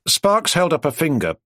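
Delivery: ordinary delivery, nothing marked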